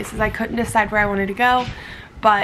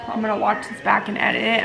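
usual speaking voice

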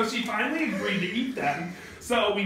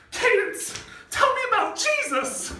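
tearful plea; 13 semitones higher than usual. Retold or imitated. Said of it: "she'd been crying".

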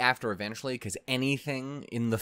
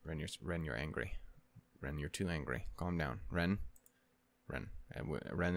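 soft coaxing; 7 semitones lower, 10 dB quieter than usual. Pretend. Soothing an imagined angry Ren.

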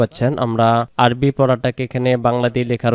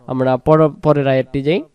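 ordinary delivery

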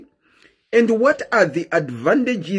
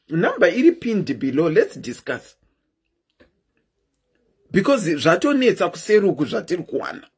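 ordinary talk, nothing marked

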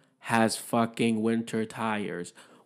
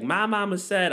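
much higher, childlike [name] imitation; [name] meme voice; 8 semitones higher than usual. Imitating the [name] meme to the listeners, high and childlike. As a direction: pitch it up like the little-kid [name] meme. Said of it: "that [name] meme when she's like".

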